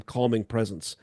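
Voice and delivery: ordinary delivery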